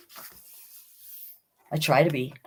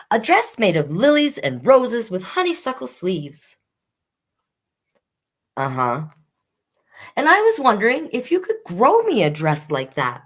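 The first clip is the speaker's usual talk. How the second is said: put-on higher, hopeful little kid voice; eager child; 6 semitones higher than usual. Bailey telling Mother the dream, higher eager child voice. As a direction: ask like a child hoping for a yes.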